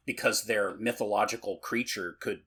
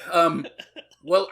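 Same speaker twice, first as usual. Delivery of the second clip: normal talking voice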